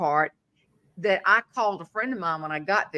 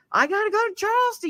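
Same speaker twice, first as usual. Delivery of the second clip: panicked; 13 semitones higher, faster than usual. Retold or imitated. Panicked rush to leave.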